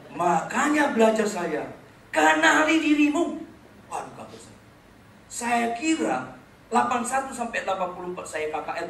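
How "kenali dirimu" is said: high, loud, slow commanding word; voice of God. Retold or imitated. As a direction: proclaim it like a voice from heaven. Voice of God speaking. (suara Tuhan yang berseru pelan dan keras)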